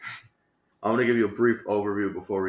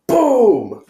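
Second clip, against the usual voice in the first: mock explosion shout; 14 semitones higher, 12 dB louder than usual. Robber bursts in.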